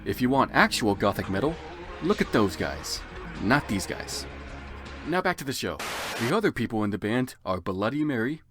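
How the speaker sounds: usual speaking voice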